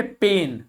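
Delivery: पेन said as "pain" with a drawn-out "ay"; teacher models पेन with a long vowel; long A sound. Shown as a demonstration. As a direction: hold the "ay" in पेन. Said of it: "ए long sound है".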